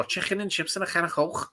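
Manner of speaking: Scouse impression for his mates; Scouse accent. Shown as a demonstration. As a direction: do a hammed-up Liverpool accent. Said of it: "that's how you do a Scouse accent".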